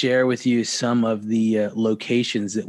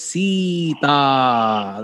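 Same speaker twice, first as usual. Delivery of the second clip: Atascosita drawn out syllable by syllable; slow pronunciation; slower than usual. Shown as a demonstration. Cohost asks host to say the town name slowly. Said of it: "slow, say slow".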